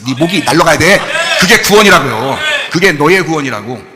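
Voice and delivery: loud, emphatic sermon delivery; forceful preaching. Acted. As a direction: drive the verdict home loudly to the congregation.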